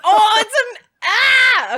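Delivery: loud, high outburst; excited yell. Real, not acted. Thrilled by the song discovery, excited yell. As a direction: burst out in a high shout.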